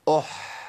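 breathy held ح closing أح; crisp أح with a lingering throat ح; demonstrated sukoon. Shown as a demonstration. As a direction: let the ح in أح flow out as audible breath. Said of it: "أقولها تاني".

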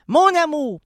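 a bigger, bolder "Mon amour". Shown as a demonstration. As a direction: say "Mon amour" with a full loud sweep. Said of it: "c'est mieux".

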